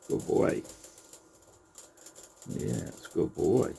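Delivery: hushed, soothing coo to a rabbit; tender near-whisper to a pet; soft pet talk. Real, not acted. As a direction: gently praise the bunny, almost breathless.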